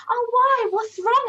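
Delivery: high, loud mock concern; concerned friend. Someone fussing over a sad friend, high mock concern. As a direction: fuss over them like a worried friend. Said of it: "someone will come up to you".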